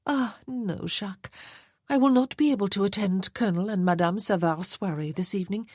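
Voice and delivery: a vain society lady teasing about her wealth; playful marchesa. Acted.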